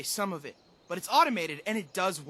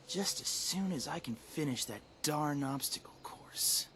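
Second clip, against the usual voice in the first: whispered aside; 5 semitones lower, 8 dB quieter than usual. Acted. Muttered aside under breath.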